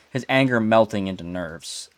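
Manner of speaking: ordinary delivery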